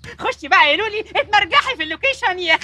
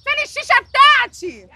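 calling out; 5 semitones higher, 6 dB louder than usual. Acted. Star calling production for shisha.

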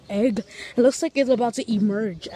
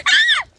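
piercing high yelp, much louder and higher; shrill dog yelp; 10 dB louder than usual. Retold or imitated. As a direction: mimic the dog's sudden shrill cry at full volume. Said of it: "he makes a shrill sound".

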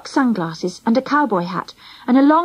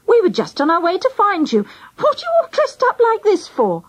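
high, astonished questioning; puzzled mum; 7 semitones higher than usual. A narrator's reading. Angela's mum questioning the disguised children, high and puzzled. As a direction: voice a mum baffled by what she sees, pitched up.

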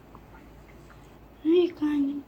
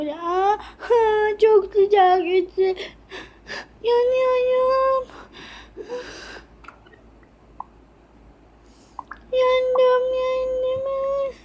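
high, slow wails of burning; mock pain cries; 8 semitones higher, much slower than usual. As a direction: wail as the hot iron burns. Daughter burned by iron.

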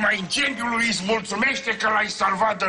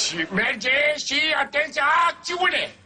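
director calling out; 5 semitones higher than usual. Acted. Director cueing the second take, calling out. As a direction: call the take, rising on action.

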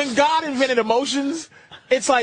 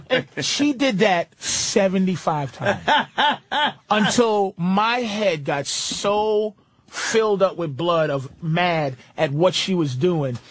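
ordinary delivery

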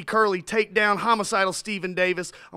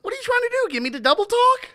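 Curly voice; 14 semitones higher, 4 dB louder, faster than usual. Acted. Curly character mock-indignant.

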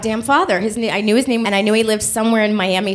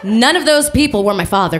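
normal talking voice

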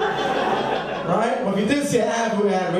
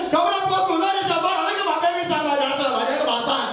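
high, proud West African father; west african accent; 6 semitones higher than usual. Retold or imitated. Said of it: "Pop shows up".

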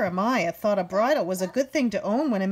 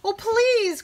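higher, louder plea; pleading cry; 11 semitones higher, 4 dB louder than usual. Acted. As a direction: beg them for the oats. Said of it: "cried Lily".

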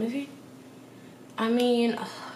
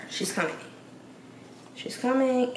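normal talking voice

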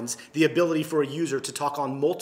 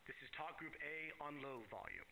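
faint voice over a radio; quiet radio check played back; radio at low volume; 27 dB quieter than usual. Shown as a demonstration. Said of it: "and I go to low volume".

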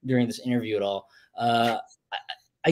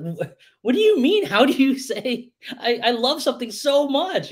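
high, baffled mock question; family confused about the rule, high and baffled; perplexed; 12 semitones higher than usual. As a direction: sound puzzled and lost. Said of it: "It's like".

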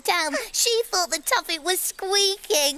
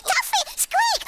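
high squeaky call; 12 semitones higher than usual. Shown as a demonstration. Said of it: "Look, I'll show you".

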